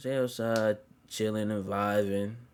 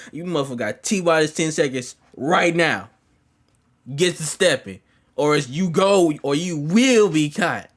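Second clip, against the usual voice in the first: raised, forceful countdown warning; loud ultimatum; 4 semitones higher, 7 dB louder than usual. Retold or imitated. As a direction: bark it out as a final warning. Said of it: "I said like this".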